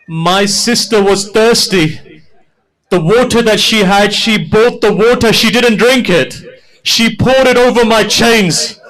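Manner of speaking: high, loud wailing recitation; preaching at full force. Acted. Preacher voicing Imam's memory of Sakina, full wailing. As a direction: pour out the grief at the top of the voice.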